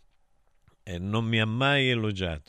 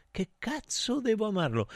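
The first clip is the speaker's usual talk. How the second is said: high, mocking outburst from the father; incredulous scoff; 12 semitones higher than usual. Father scoffing at his son's plea for love, high mocking disbelief. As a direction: throw the words back in disbelief, much higher.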